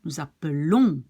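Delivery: "appelons" with a stressed, lengthened -lons; stress after the L. Shown as a demonstration. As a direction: push the last syllable of "appelons". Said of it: "you put the stress after the L".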